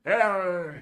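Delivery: loud Southern hello; drawn-out Southern accent attempt. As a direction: greet them in a put-on Southern accent. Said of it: "do a Southern accent".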